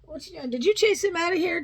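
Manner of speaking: ordinary delivery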